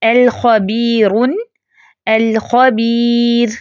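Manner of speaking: الخبیرن then الخبیر, each drawn out very slowly; measured Quranic recitation. Shown as a demonstration. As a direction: recite the example slowly, word by word. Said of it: "اسی طرح اگلی مثال ہے".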